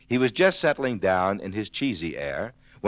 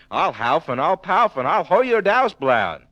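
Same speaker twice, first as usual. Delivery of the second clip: higher, louder huffing wolf growl; wolf threat; 7 semitones higher, 4 dB louder than usual. Acted. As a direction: huff it out like the big bad wolf. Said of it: "wolfered the ants".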